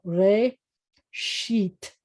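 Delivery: re, then sheet, with a clear pause between; sounded out. Shown as a demonstration. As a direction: say re and sheet as separate syllables. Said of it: "can you sound it out?".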